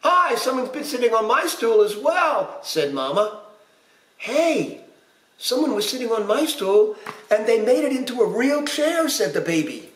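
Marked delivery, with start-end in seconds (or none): 0.0-2.6 high-pitched put-on mama voice; high storybook complaint; indignant pirate mama. A narrator's reading. Mama voiced echoing Papa's outrage. 4.2-9.1 put-on high little baby voice; high-pitched storybook baby; indignant pirate baby. A narrator's reading. Baby voiced complaining about stool.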